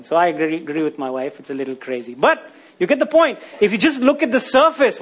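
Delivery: ordinary talk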